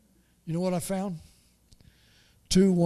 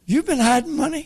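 shocked wife; 9 semitones higher, 6 dB louder than usual. Retold or imitated. Wife finds the hidden money.

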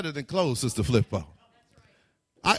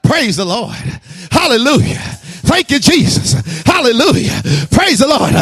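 loud, high, happy hallelujahs; joyful praise; 4 semitones higher, 13 dB louder than usual. Shown as a demonstration. Preacher showing the old church entrance, jubilant. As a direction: shout your praise as you skip in. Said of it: "you come into church skipping".

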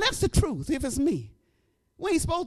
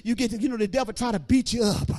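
loud, higher preaching sliding into half-sung lines over music; full-force preaching; 5 semitones higher, 9 dB louder than usual. Acted. Preacher promising the weary that God will bring them back, loud sung preaching. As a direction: preach it hard, then let it sing.

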